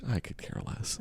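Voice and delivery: breathy whispered shrug; whispered indifference. Retold or imitated. Mock indifferent dating quote.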